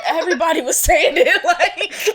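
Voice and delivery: loud, high, talking through laughter; real laughter bubbling through the words; laughing. Real, not acted. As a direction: laugh as you protest. Laughing over retold gossip.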